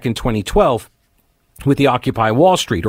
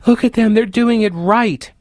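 mock fawning; 7 semitones higher than usual. Mocking the fawning media.

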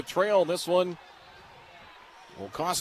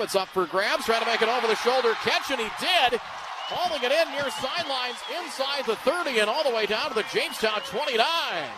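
excited call; 8 semitones higher than usual. Real, not acted. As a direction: build the call as the catch is made. Deep catch called live.

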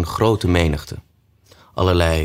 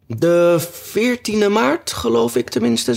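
very high, slow, drawn-out character voice; unsure hatter; slower than usual. A narrator's reading. Hatter answering the king about the date, unsure high voice. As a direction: pitch it way up and stretch the words.

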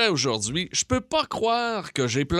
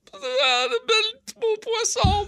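very high blubbering squeal, imitating himself; high mock-crying, words melting into tears; mock bawling. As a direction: wail it hammed-up through fake sobs. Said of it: "je me mets à broyer comme un veau".

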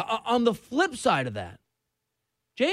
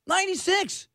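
disbelief; 10 semitones higher than usual. Real, not acted. Repeating stat in disbelief.

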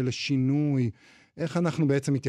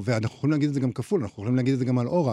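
ordinary talk

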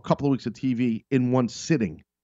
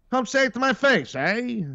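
put-on much higher old-movie tough-guy challenge; gangster tag line; 11 semitones higher than usual. Showing how the movie gangsters end a sentence, much higher. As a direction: toss off the tough guy's closing dare. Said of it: "would finish the sentence all the time with".

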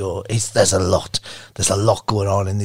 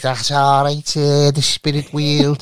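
put-on Scouse accent; slower than usual. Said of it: "Derek Acora is a Scouse fella".

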